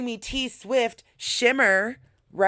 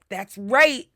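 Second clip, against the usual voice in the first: hyped self-talk; 3 semitones higher, 7 dB louder than usual. Retold or imitated. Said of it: "And then I was like".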